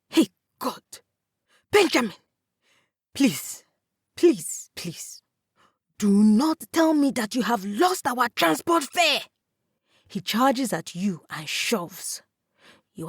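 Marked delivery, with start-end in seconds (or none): 0.1-9.2 furious brother shouting and begging at once; raging outburst. A narrator's reading. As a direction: explode at a brother who lost the fare. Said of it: "his expression morphing from confusion to rage".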